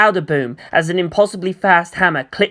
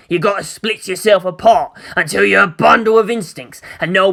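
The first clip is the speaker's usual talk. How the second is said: ranting orc; 2 semitones higher than usual. Acted. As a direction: bark it like a greenskin warboss. Orc voiced as character.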